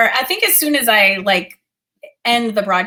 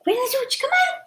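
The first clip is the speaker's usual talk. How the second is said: high, soft pleading to a pet; coaxing the dog; 13 semitones higher, 3 dB quieter than usual. Real, not acted. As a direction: urge your dog gently, pitched way up. Still coaxing dog for kiss.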